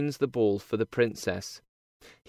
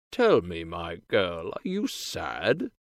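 lower, gentle king father; 5 semitones lower than usual. A narrator's reading. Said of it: "he asks her".

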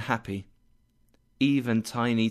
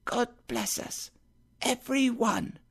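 put-on high, soft little boy's voice; a sickly child echoing his father gently; Tiny Tim echoing his father softly, high and light; small child; 11 semitones higher, 5 dB quieter than usual. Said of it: "said Tiny Tim".

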